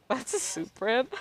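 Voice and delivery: squeaky high giggling repeat of the question; real laughing disbelief. Real, not acted.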